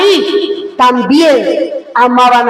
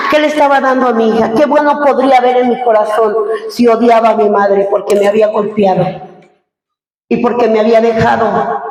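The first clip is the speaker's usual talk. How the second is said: usual speaking voice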